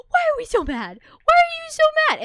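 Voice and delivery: loud, very high squealed questions; exaggerated pet-owner voice at full volume; mock scolding dog talk. Shown as a demonstration. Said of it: "and I go".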